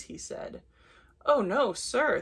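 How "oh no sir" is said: higher, louder mild protest; crying out. A narrator's reading. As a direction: plead innocence with a storyteller's light cry. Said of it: "they cried".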